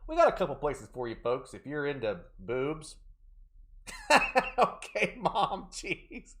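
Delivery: usual speaking voice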